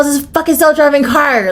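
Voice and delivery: retold fuming complaint about the car; mad outburst. Rider fuming at Waymo staff over her purse, mad. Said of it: "I was so mad".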